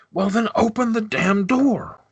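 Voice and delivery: high, exasperated order; lord calling from inside. Acted. Lord inside ordering his guard to open up, exasperated. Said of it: "you kind of hear faintly".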